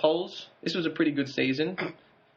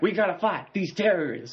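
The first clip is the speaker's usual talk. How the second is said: mock presidential rallying call, pitched up; George W. Bush; 6 semitones higher than usual. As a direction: do a higher, put-on politician's voice. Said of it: "That's a George W".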